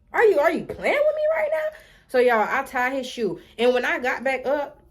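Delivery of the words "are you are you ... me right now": much higher, squeaky incredulity; can't believe what she's hearing; outraged disbelief. Retold or imitated. Kid asking the teacher if she's joking, outraged disbelief.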